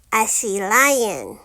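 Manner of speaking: plain reading voice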